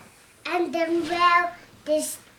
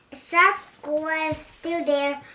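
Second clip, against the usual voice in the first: usual speaking voice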